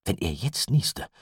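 ordinary delivery, nothing marked

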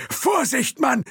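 high, loud crowed warning; crowing shout. Acted. Thompson shouting at the driver, crowing with alarm. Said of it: "krähte Thompson".

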